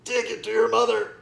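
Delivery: father growling at his son. A narrator's reading. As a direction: turn away and growl it. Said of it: "and growls".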